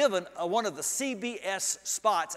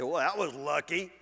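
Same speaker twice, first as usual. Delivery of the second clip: mild, lower-pitched sheepish aside; 5 semitones lower than usual. Acted. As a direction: play down the luck, modestly. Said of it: "grin sheepishly and say".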